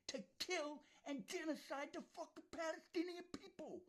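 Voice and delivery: high, loud, furious accusation; furious shout. Real, not acted. Raging at Netanyahu over genocide, furious shout. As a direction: hurl it at him in real rage.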